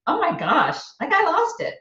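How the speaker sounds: high-pitched mock dismay; sheepish, squeaky-high re-enacted admission; retold dismay. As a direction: let the voice jump up as you confess. Retold admission to her kids.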